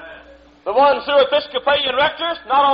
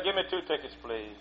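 meek request; 9 semitones lower, 16 dB quieter than usual. Retold or imitated. Meek customer answers barker.

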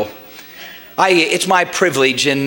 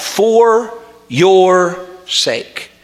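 FOR, YOUR, SAKE each set apart and drawn out; slow right down and land "for your sake" one word at a time; deliberate word-by-word emphasis; much slower than usual. Real, not acted. Preacher spaces out key words.

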